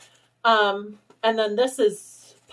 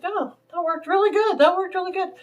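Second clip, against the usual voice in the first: delighted; 10 semitones higher, 4 dB louder than usual. Retold or imitated. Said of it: "I was like".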